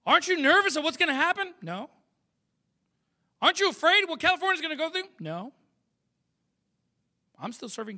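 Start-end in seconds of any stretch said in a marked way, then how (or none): 0.0-1.5 mock-anxious question, high and loud; anxious questioner. Preacher voices nervous people questioning him, high mock alarm. 3.4-5.1 high, fast mock-worried question; anxious questioner. Preacher voices people afraid for California, rushed high mock worry.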